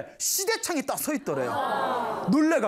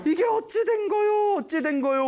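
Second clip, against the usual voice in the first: wailing; 11 semitones higher than usual. Retold or imitated. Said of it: "울부짖다가".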